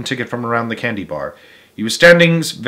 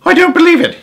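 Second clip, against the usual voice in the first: disbelief; 7 dB louder, faster than usual. A narrator's reading. Disbelieving father.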